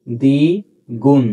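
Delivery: drawn-out দ্বিগুণ, ব silent; slow demo. Shown as a demonstration. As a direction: stretch দ্বিগুণ slowly as Digun with no ব. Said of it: "বফলা উচ্চারিত হবে না".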